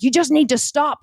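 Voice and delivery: mildly angry retelling; retold angry husband. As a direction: mimic an irritated husband laying down the law. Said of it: "him getting really angry".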